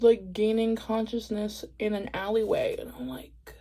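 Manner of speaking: breathy whisper, dropping away to nothing; soft unvoiced whisper; hushed whisper. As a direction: whisper it like you've just come to somewhere strange. Waking up in a dark alleyway.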